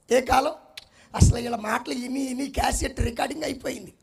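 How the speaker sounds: ordinary talk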